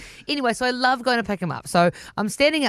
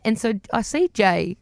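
ordinary talk

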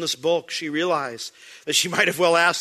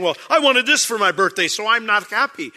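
mock whiny brat, a bit louder; hammed-up bratty complaint about a birthday; spoiled brat; 5 semitones higher, 5 dB louder than usual. Preacher mocks a selfish brat's birthday whining. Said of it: "like someone else saying".

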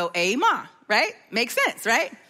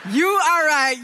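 emphatic concession; 5 semitones higher, slower than usual. Acted. Comic conceding to grandmother.

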